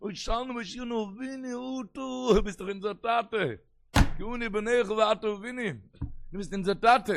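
high, loud, impassioned plea; sustained high liturgical outcry; crying out in prayer. Acted. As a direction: shout the prayer up to a father. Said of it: "מיט שרייט דער שנה רבה".

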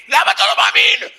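very high, loud scream; shouted comeback. Shown as a demonstration. Student pressing the frightened heckler, full-throated shout. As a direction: scream it without any fear. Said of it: "תצעק, אל תפחד".

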